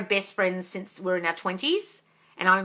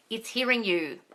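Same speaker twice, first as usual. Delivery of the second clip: normal talking voice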